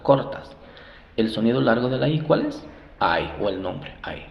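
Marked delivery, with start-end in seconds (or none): none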